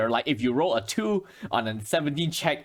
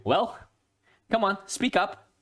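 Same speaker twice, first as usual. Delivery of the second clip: stern man; 7 semitones higher than usual. Acted. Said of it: "this very stern looking man".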